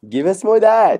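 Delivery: a playful imitation of a pushy judge. [name] demanding more Frenchness.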